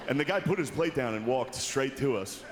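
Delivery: normal talking voice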